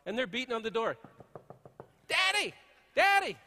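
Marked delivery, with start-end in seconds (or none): 2.1-3.4 put-on high loud child's shout of Daddy; kids calling. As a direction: yell it like kids pounding the door. Kids beating on the door.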